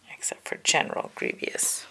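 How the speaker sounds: usual speaking voice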